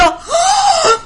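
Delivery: very high exaggerated gasp of indignation; mock gasp. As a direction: gasp as if deeply offended. Acts out her indignant gasp. (grito ahogado, agudo y fingido)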